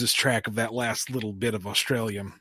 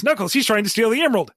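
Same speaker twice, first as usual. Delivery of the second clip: high, loud, rushed accusation; mock alarmed tattling; 9 semitones higher, 6 dB louder, faster than usual. Rouge pointing out Fang to Knuckles, mock alarm. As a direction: raise a hammed-up alarm.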